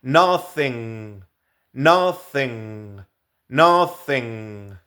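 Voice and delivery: nothing with a voiceless th in the middle; unvoiced th in nothing. Shown as a demonstration. Teacher modelling nothing with an unvoiced th. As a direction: repeat nothing slowly, th unvoiced. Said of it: "Otro ejemplo es nothing".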